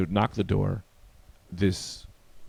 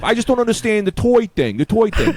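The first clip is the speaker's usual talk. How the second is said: high, loud mock bafflement; raised-pitch retold exasperation; baffled neighbor; 10 semitones higher, 5 dB louder than usual. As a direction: imitate a neighbor protesting in bewilderment. Acted quarrel over toys.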